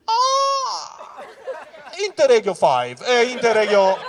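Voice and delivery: loud, high general with a laugh; general's voice. Acted. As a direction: bark out the train like a pompous general. General answers, acted voice.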